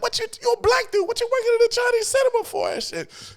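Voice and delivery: high astonished inner thought; disbelief. Retold or imitated. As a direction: squeak out the shock. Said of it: "in my mind, I'm like".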